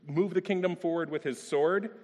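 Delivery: ordinary talk, nothing marked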